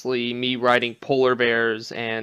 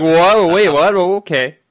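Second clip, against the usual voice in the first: high, louder double take of disbelief; mock stunned reaction; 5 semitones higher, 7 dB louder, slower than usual. Imitating a friend's stunned reaction to the news, louder and higher. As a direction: gasp it out like the news just landed. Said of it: "You're just like".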